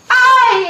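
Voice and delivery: high loud yelp of pain; cry of pain. Acted. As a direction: yelp as if bitten. Mordida en el hombro. (grito agudo de dolor por la mordida)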